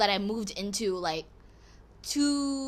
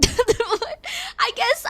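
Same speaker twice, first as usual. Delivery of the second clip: high laughing "I guess"; giggling self-mockery; 13 semitones higher, 4 dB louder than usual. Real, not acted. Laughing at herself.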